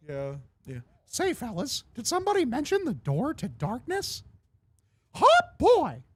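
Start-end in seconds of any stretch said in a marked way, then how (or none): 1.1-4.2 high, swooping cartoon mouse; Mickey Mouse. Streamer quotes a game clip, high Mickey voice. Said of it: "my Mickey Mouse impression". 5.1-6.0 high, loud cartoon mouse yelp; Mickey Mouse. Streamer quoting a game clip, loud Mickey yelp. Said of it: "He's our best Mickey".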